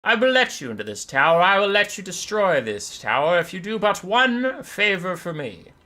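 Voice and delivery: loud, high, imperious elf calling down; Teclis. Acted. Elf mage bargains with the bird below, lofty loud proclamation. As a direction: proclaim it grandly from a tower top.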